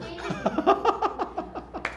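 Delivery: unvoiced, drawn-out burst of laughter; real laugh at an unexpected answer; amused laughter. Real, not acted. Preacher amused by a child's answer, laughing. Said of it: "아, 재밌어져요".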